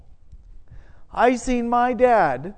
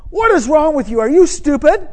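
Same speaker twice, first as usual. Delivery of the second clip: scolding parent; 8 semitones higher, 8 dB louder than usual. Retold or imitated.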